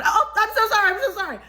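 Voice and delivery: high, laughing, embarrassed sorry; flustered apology. Retold or imitated. Walking in on the bride, a high nervous laughing apology.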